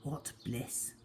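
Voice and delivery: ordinary delivery, nothing marked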